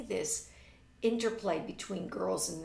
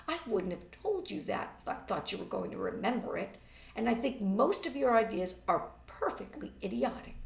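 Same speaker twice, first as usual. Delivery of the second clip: ordinary talk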